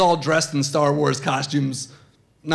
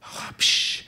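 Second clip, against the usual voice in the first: put-on child copying a masked villain's breath; mimicked villain breathing; 6 dB quieter than usual. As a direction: puff out a mechanical breath. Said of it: "she goes, uh".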